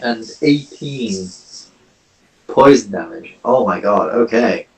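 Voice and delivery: ordinary talk, nothing marked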